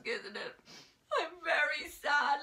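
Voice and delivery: hammed-up sad whimper; mock crying. Drama teacher acting out the sad mask, mock crying. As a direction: pretend to cry like a sad mask. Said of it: "It's a sad mask".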